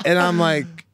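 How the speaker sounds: ordinary talk, nothing marked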